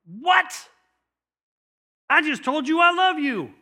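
high, loud protest as Peter; incredulous Peter. Retold or imitated. Peter protests to Jesus, high and indignant.

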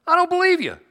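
high, loud, sassy refusal; defiant disbelief. Acted. Believers brushing off God's word, sassy and high. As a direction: brush God off, rising in pitch. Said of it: "we're like".

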